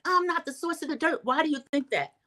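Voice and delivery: high, pleading imitated denial; hurt protest. Vlogger mimics Lisa denying to Heather, high pleading.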